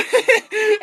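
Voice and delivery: a burst of high-pitched laughter; a cackle cracking up at the cyclist. Real, not acted. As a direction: break up laughing.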